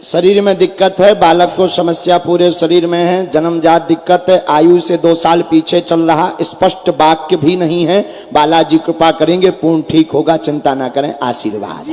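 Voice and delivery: loud, steady declaration; reading a pronouncement aloud. Real, not acted. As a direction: declare each finding firmly to the crowd. Reads out the written pronouncement.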